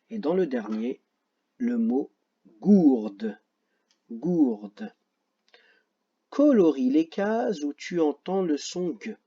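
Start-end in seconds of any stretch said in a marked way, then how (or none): none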